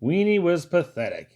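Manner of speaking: ordinary delivery, nothing marked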